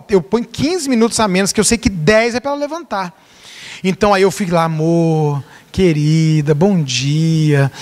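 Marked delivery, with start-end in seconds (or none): 4.6-7.7 tender wake-up greeting. Retold or imitated. Husband gently waking his wife on Valentine's Day, tender and low. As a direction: coax her awake gently, lovingly.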